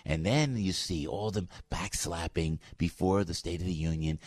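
ordinary talk

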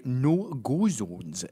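slow, drawn-out Scottish vowels; mock Glaswegian "No go zones"; mock Scottish accent. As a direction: lean hard into the Scots accent. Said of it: "I'm listening to your accent here as you say".

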